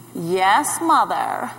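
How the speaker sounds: low, droning son's reply; mimicked dull son. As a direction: mimic a bored son answering mother.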